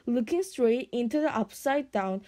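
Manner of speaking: usual speaking voice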